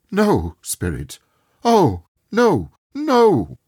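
rising into a desperate wail; terrified crying out. A narrator's reading. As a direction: Scrooge pleading before his own grave. Scrooge sees own grave.

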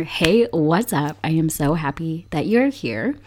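ordinary talk

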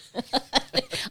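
high-pitched laugh. Real, not acted. Mom put on the spot to tell a story, laughing. Daughter asks her for the story.

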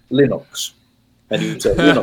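"Linux" said the Finnish way, LEE-nooks; long ee and oo sound in "Linux"; pedantic Linux. Shown as a demonstration. Guest showing the tremendously pedantic way, LEE-nooks for "Linux". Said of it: "were tremendously pedantic".

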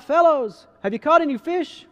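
loud, high call. A narrator's reading. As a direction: holler to fishermen out on the water. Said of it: "he called out".

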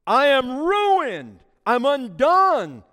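very slow, loud, high anguished wail; anguished cry. Acted. Prophet before God's throne, slow anguished wail. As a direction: cry out in despair. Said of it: "I cried".

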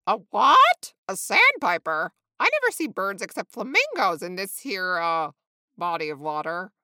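loud, incredulous outburst; big-voiced astonishment, easing off at the end; baffled flamingo. A narrator's reading. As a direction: exclaim it, never having heard of such a bird. Flamingo amazed by sandpiper.

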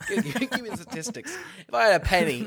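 loud, high, talking through laughter; laughing. Real, not acted. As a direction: tease your co-host while cracking up. Teasing co-host, breaking into laughter.

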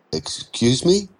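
surprised, unsure he heard right; puzzled question. Acted. Andrei puzzled when Mitch offers lunch, slow rising question.

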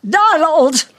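loud, high scolding cry of his name; shocked rebuke. Acted. Wife shocked by husband's morbid talk, loud scold. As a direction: slap him down with his name.

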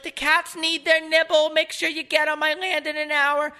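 hammed-up dotty old lady leaving a voice message. Old lady on the plane dictating a voice text, high and squeaky. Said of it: "She was like".

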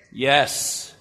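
ordinary delivery

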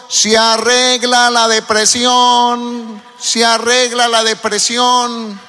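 imitating a street crier calling out slowly; street vendor call. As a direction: call it out like a vendor passing at dawn. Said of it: "y pasan diciendo".

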